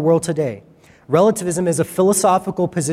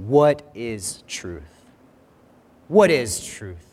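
slow, low, exasperated Pilate; weary, frustrated rhetorical question; 7 semitones lower, slower than usual. Retold or imitated. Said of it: "He's just kind of frustrated".

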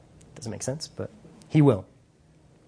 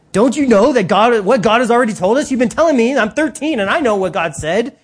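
put-on loud, higher, fast teenage lecture to mom; exasperated teenager; 5 semitones higher, 5 dB louder than usual. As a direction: scold mom like a know-it-all thirteen-year-old.

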